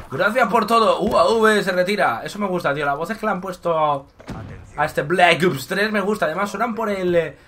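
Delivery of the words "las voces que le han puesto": ordinary delivery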